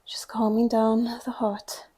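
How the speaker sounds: soft whisper, calming down; whispered cue. Real, not acted. Instructor calming the class after the last exercise, whispering. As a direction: breathe the words out in a whisper.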